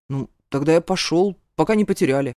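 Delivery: lightly grumbling male guard. A narrator's reading. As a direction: grumble a reluctant goodbye. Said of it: "пробурчал". (ворчливо)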